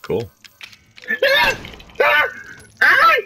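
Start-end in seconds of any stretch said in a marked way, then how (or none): 1.2-3.1 scream. Real, not acted. Jump scare from bugs.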